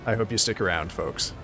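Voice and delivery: ordinary talk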